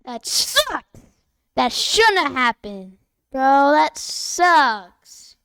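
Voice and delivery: loud, exasperated gamer outburst; loud frustration. Real, not acted. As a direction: complain at full volume after losing. Complains loudly after losing race.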